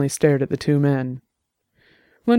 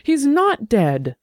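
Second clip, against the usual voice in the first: slow, high, near-tearful cry; shouted near tears; 9 semitones higher, much slower than usual. A narrator's reading. Suspect breaking down before investigator, tearful shout. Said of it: "Lundberg shouted, almost in tears".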